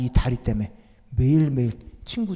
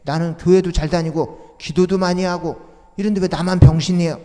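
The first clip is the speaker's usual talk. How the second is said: louder, rising anguished complaint; resentful protest; 5 semitones higher, 7 dB louder than usual. Retold or imitated. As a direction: pour out bitterness at mother. Son protesting bitterly to mother.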